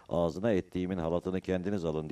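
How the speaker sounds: usual speaking voice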